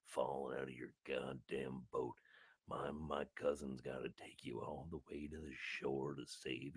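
low, indignant seal character; accented seal. Acted. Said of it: "I don't know why I've got this accent now".

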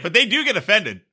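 normal talking voice

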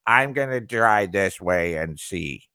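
whiny baby voice mocking a customer. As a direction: mimic a stubborn customer as a big baby. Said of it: "in that baby voice".

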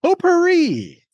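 high, slow game-show call of POTPOURRI; high drawn-out announcement. Acted. Host announcing the chosen category, high drawn-out voice. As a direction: sing out the category name, stretched.

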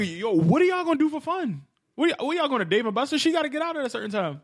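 high-pitched teasing questions; mock incredulous. Ribbing a friend over his younger date, mock incredulous. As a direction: pitch it up as if baffled by a buddy.

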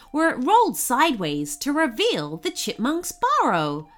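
usual speaking voice

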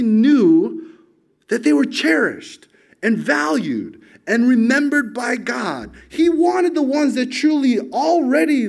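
high, loud passionate sermon voice; preaching. Acted. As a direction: build the congregation's wonder, slow and ringing. Preaches at full force.

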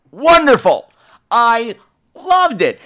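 high, loud gushing with pauses for effect; gush, landing hard on each phrase; emphatic delight. Real, not acted. Reviewer praising the opening scene, high emphatic delight.

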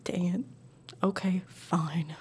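soft sulky whisper. Real, not acted. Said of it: "Don't say it again".

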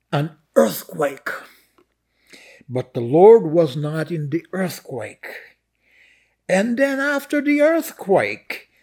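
slow, swelling declamation with long pauses; dramatic scripture reading. Acted. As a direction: proclaim each phrase, then let it hang.

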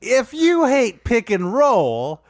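Dwight Howard voice. Acted. Podcaster reading his Dwight Howard joke slow and high. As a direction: stretch it out slowly in a put-on voice. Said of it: "It's a Dwight Howard thing".

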